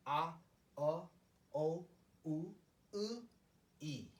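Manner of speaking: "A, uh, o, u, e" one by one with pauses; Korean vowel recital. Shown as a demonstration. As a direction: recite each vowel as an isolated sound. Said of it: "These are some of the basic Korean vowels".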